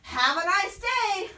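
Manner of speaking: high, drawn-out call goodbye; yell. Acted. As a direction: sing out a farewell across the yard. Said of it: "she yelled".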